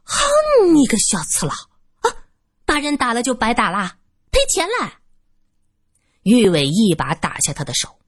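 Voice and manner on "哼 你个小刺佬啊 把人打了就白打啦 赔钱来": 高亢刁蛮的老鸨腔，大声嚷; 扯着嗓子讹钱; 尖声泼辣老妇. Acted. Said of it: "大声说道". (妖艳老女人高声嚷嚷讨钱)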